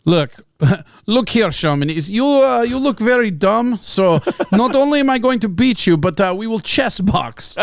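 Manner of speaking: comic Russian boast, breaking into laughs; Russian accent. Acted. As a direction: taunt in a mock Russian accent. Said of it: "you can do a Russian accent".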